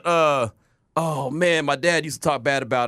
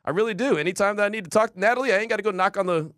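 ordinary talk

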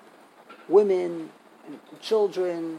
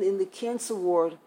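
ordinary talk, nothing marked

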